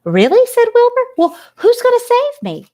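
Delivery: high, loud, amazed pig; surprised piglet. Acted. Startled Wilbur asks Charlotte who will save him, high and loud. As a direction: burst out with sudden hope.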